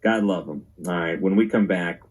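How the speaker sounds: normal talking voice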